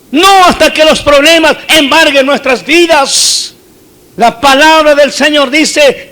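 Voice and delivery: loud, fervent preaching to the church; rally the congregation to serve God; full-force sermon, calling out to the faithful. Acted.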